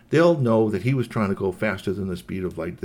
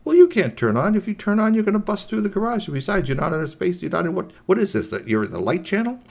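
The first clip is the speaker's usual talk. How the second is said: higher-pitched, insistent robot objecting; argumentative robot; 11 semitones higher, 5 dB louder than usual. Acted. Robot told to explain why turning on is pointless, argumentative. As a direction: argue it back, high and emphatic. Figure argues back in the story.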